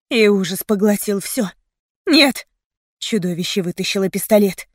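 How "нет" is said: louder, higher shout of protest; desperate cry. A narrator's reading. Heroine seeing a pistol drawn, desperate cry. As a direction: cry out in horror.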